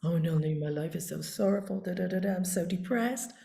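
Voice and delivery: low, subdued imitation of a depressed client; mock complaining. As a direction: moan softly like a sorrowful client. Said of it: "somebody came in the other day complaining".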